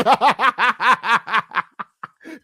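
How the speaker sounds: a high rising burst of laughter; cracking up, high and loud. Real, not acted. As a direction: laugh loud at your own joke. Laughing at own joke.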